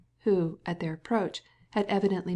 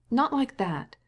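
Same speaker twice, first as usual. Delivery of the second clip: higher sharp rebuke; cross; 6 semitones higher, 3 dB louder, slower than usual. A narrator's reading. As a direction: scold the helper, irritated. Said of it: "she said crossly to Varenka".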